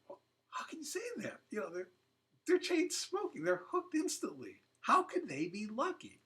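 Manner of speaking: high, disbelieving questions from a doubter; incredulous objection. Retold or imitated. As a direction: voice the listener who can't believe it. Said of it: "people sometimes wonder".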